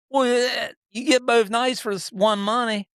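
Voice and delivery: mock lady clerk. Quoting the sign-up lady.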